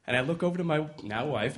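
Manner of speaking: usual speaking voice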